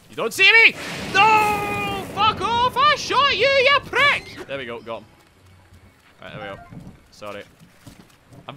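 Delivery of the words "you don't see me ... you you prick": very loud, high yelling at the enemy; furious gamer shout. Real, not acted. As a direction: scream at the monster you just shot. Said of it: "I don't know why I'm shouting".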